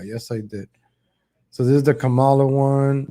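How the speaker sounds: usual speaking voice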